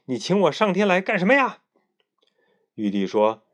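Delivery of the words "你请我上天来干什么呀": much higher, brash monkey calling out to the emperor; an impudent monkey with no court manners; 顽皮猴王; cheeky Monkey King. A narrator's reading. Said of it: "孙悟空问道".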